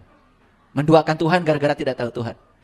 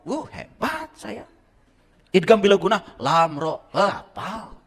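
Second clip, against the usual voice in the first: higher, slow self-congratulation; mock boasting; 6 semitones higher, 3 dB louder, slower than usual. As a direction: pat yourself on the back, hammed-up. Mock self-praise after reciting. (bangga diri yang dibuat-buat)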